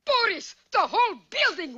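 alarmed cry. Acted. Building explodes, Natasha alarmed.

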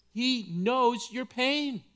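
ordinary delivery

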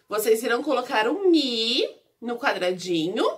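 normal talking voice

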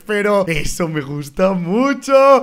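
ordinary talk

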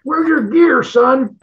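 high-pitched mock southern drawl of the commander; imitating a southern commander. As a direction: play the southern battalion commander, lightly. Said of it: "he had this southern accent".

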